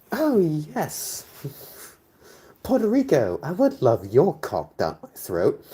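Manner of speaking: very high, hammed-up eager voice; mock falsetto. Mock patriot gushing at Puerto Rico, exaggerated high voice. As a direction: gush it slowly in falsetto.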